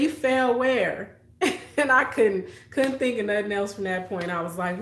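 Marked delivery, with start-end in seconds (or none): 0.0-1.2 slow, drawn-out doubting question; a mother who isn't buying it; suspicious mom. Retold or imitated. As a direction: mom doubting her child.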